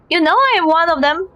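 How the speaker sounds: normal talking voice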